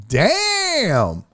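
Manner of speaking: ordinary talk, nothing marked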